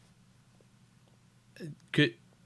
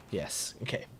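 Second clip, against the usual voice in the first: whispering; 6 semitones lower, 13 dB quieter than usual. Real, not acted. Quiet aside while playing.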